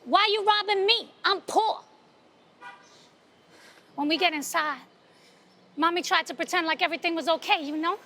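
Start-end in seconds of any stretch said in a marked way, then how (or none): 0.0-1.8 higher, louder pleading mother; a touch of a desperate mom. Retold or imitated. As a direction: beg the robber not to take it. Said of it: "then she said".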